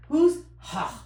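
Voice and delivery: high squealing laugh breaking in. Real, not acted. Host cracking up at the nickname, laughing. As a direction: burst out laughing at the joke.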